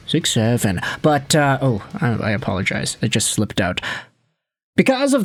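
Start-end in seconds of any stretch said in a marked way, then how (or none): none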